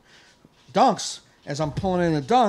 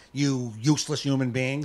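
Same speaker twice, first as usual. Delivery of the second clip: ordinary talk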